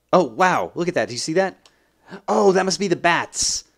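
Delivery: loud, high-pitched amazement; excited discovery. Real, not acted. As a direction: exclaim at a thrilling first sighting. First sight of bats in game.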